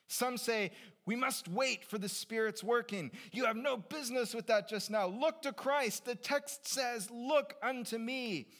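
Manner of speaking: high, loud pulpit bellow; fervent preaching. Acted. Rustic preacher correcting those who wait, bellowing fervently. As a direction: drive it home from the pulpit at full force. Acted preacher at full force.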